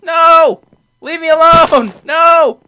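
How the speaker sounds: very loud, high shouts; panicked yelling. Real, not acted. Player attacked in game begging to be left alone, panicked yelling. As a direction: yell at attackers to back off.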